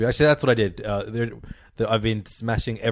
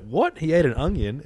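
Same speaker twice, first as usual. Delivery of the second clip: high, incredulous retort; mock disbelief; 6 semitones higher than usual. Comedian reacting to audience question, imitated disbelief. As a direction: retell it as a startled question. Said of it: "then he was like".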